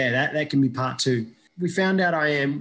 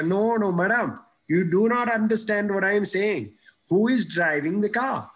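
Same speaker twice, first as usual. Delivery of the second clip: put-on higher, pushing Indian accent; exasperated Indian-accented boy, higher; insistent Indian accent; 7 semitones higher than usual. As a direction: repeat the question in an Indian accent, getting insistent. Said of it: "I had an Indian accent at the time".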